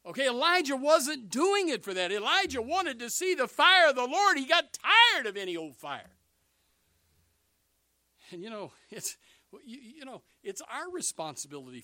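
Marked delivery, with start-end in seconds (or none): 0.1-6.2 loud, high-pitched pulpit proclamation; full-force preaching. Acted. Preacher to congregation on Elijah's motives, full-force preaching. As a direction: drive it home at full volume.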